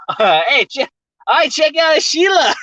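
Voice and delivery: high, excited mock Australian; laughing Australian catcall; put-on Aussie accent. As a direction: call out like a hammed-up Aussie bloke.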